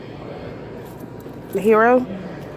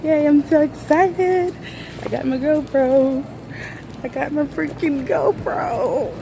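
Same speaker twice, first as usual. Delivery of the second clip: higher, slow, gushing squeals of joy; bursting with excitement; 7 semitones higher, slower than usual. Real, not acted. Vlogger to viewers over her new GoPro, bursting with excitement. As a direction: let the thrill spill out, drawn out with a laugh. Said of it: "I just needed to let that out".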